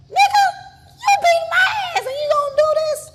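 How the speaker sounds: very high, loud indignant shout; incredulous outrage. Retold or imitated. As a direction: shriek in disbelief at their nerve. Retells outrage at attacker's crying.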